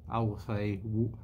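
talking around a cigar; cigar in mouth. Real, not acted. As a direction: lips closed on cigar. Seen 0: Cigar clamped between lips from 0.1 to 1.1s, then removed.

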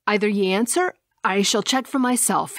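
usual speaking voice

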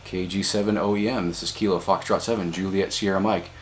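normal talking voice

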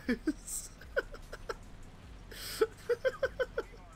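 airy wheezing laugh; breathless laugh. Real, not acted. Keeps laughing after the joke.

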